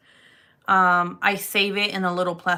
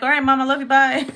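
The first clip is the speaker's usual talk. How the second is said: higher, louder quick brush-off; meek goodbye; 6 semitones higher, 7 dB louder, faster than usual. Retold or imitated. To her mom, backing off with a higher, louder goodbye. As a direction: back off sweetly from mom. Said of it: "I'm kinda like".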